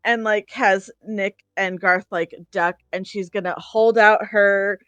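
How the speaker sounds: normal talking voice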